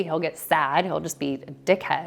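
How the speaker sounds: usual speaking voice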